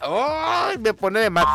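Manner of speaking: high, drawn-out "ay" of annoyance; long exasperated whine. Real, not acted. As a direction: groan it high, driven crazy by mosquitoes. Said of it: "me desespera".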